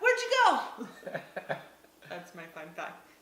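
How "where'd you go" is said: higher, louder teasing call with a laugh; mock call. Husband teasing his daydreaming wife, loud laughing call. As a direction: tease her back from a daydream.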